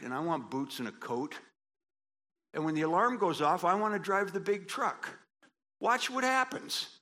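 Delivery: high, loud pulpit voice; impassioned sermon climax; full-force preaching. Real, not acted. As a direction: preach it out with rising fire.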